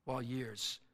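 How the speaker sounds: loud, high, declaimed poem that fades at the end; preacher reciting a poem to his flock, declaimed; poem recitation. Acted. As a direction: recite it like a rising hymn of testimony.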